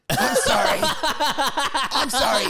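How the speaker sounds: high, drawn-out mock sorry; hammed-up whiny apology. Bully whining sorry into the beaten boy's ear.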